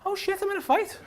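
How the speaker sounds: high, hushed mock alarm; mock panic. Bystander realising the fight has reached him, mock panic. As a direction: squeak out a retold panicked realisation. Retold alarm at being challenged.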